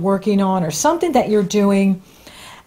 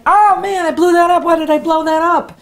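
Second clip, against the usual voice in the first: mock self-dismay; 7 semitones higher than usual. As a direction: play your own past self groaning over a mess. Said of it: "And then I'm like".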